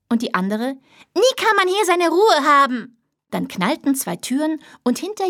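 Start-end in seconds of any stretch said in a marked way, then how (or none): 1.1-3.0 high, loud, fed-up yell; angry child shout. Acted. Second child screaming at the family, loud and high. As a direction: scream it and slam the door. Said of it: "Die eine schrie".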